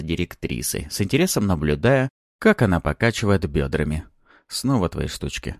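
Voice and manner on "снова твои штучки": ordinary delivery, nothing marked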